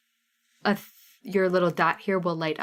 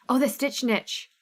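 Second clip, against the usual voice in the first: Midwestern O; 5 semitones higher than usual. Pretend. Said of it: "That was a wonderful Midwestern O".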